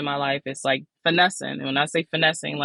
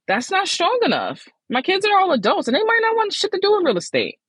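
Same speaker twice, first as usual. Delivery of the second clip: emphatic realization. Real, not acted. Rejecting own reasoning, pitch jump.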